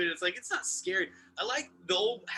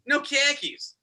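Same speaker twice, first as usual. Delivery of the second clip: put-on Boston accent; 7 semitones higher, slower than usual. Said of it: "making fun of people with Boston accents".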